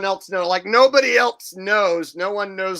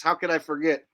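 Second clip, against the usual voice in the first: normal talking voice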